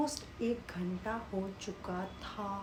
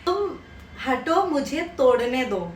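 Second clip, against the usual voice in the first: loud, higher commanding shout; brother's urgent order; 3 semitones higher, 9 dB louder than usual. Retold or imitated. Brother shouting through the locked door, urgent and loud. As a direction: push her aside and take charge, loudly. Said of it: "तो भैया बोलते हैं".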